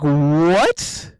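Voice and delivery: replaying his stunned reaction; retold shock. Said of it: "I was like".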